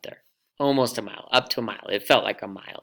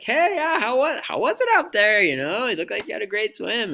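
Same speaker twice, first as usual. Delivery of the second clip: cheery friends; 14 semitones higher, 6 dB louder than usual. Retold or imitated.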